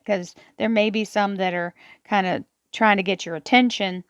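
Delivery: normal talking voice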